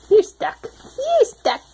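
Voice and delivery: high loud playful teasing. Real, not acted. Mother to baby.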